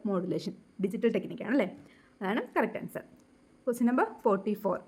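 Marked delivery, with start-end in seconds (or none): none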